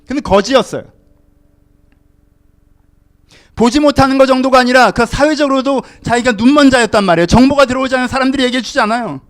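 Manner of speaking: loud, high, urgent preaching voice; forceful preaching. Real, not acted. (힘주어 외치는 설교)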